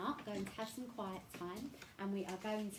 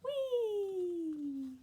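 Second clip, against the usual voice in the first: gleeful whee; 9 semitones higher, 5 dB louder than usual. Acted. Playing together.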